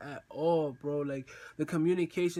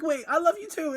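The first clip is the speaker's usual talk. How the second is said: high, excited reenacted declaration; giddy love declaration; 11 semitones higher, 4 dB louder than usual. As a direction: blurt it back high, playfully giddy. Acting giddy lovers' exchange.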